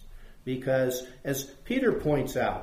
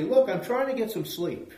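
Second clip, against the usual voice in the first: higher-pitched, lightly acted annoyed yell; mock yelling down; 6 semitones higher than usual. Woken householder to someone knocking on the door, mock yell. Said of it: "they yell down".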